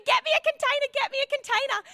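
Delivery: high urgent mock scream; panicked screaming. Telling the congregation she yelled for a box, high panicked call. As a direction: shriek for help, half-laughing at yourself. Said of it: "So I'm screaming".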